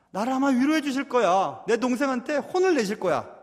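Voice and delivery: confident expectation. Acted. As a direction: act out hopeful certainty. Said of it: "분명히 확신을 했을 것 같습니다".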